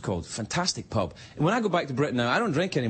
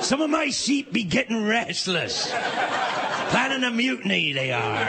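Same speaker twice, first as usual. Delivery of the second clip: pirate farmer voice; 6 semitones higher than usual. Retold or imitated. Local farmer at the bar, hammed-up pirate accent. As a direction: growl it out like a salty old pirate farmer. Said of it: "he talked like a pirate".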